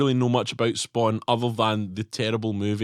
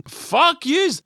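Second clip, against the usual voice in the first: mock insult; 3 dB louder than usual. Mock rant at listeners.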